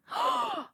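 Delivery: very high sudden gasp; sharp high gasp. Real, not acted. Streamer struck by an idea, sharp high gasp.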